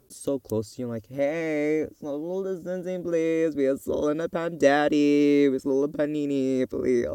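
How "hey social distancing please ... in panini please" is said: high, drawn-out mock-polite pleading; singsong reminder, stretching out the words; playful singsong plea. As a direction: plead in a lilting, put-on voice. Acted plea to someone too close.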